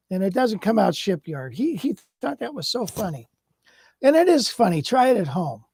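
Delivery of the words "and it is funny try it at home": usual speaking voice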